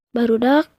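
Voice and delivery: ordinary talk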